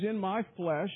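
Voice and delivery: ordinary delivery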